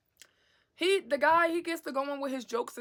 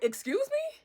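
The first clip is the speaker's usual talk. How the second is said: high incredulous question, rising sharply; baffled; 13 semitones higher than usual. Retold or imitated. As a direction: let the pitch leap up in baffled disbelief. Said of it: "I'm just baffled".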